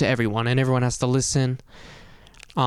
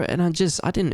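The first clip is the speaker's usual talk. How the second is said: usual speaking voice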